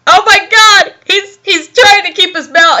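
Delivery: loud, high exclamation of disbelief; amused outburst. Real, not acted. Reader reacting to George swigging Listerine, astonished outburst. Mock-shocked reaction to the story.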